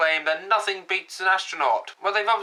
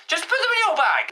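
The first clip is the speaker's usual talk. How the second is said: exasperated yell; 9 semitones higher than usual. Acted. Scolding viewer about forgotten oxygen.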